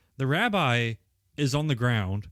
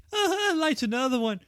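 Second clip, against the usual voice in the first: very high short yelp; mock startled yelp; 12 semitones higher than usual. Recliner flinching at the match, very high yelp. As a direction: squeak it out as the match goes. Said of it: "and he goes".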